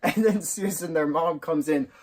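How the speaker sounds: high-pitched telling through laughter; real giggles squeezing the voice; laughing while retelling. Real, not acted. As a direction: barely get the story out for laughing. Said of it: "I cannot retell the scene without hurting myself of laughter".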